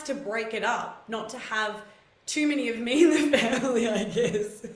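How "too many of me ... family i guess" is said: louder, breaking into laughter mid-sentence; laughing. Real, not acted. Joking about too much of herself in the family, laughing. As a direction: laugh through the self-teasing joke.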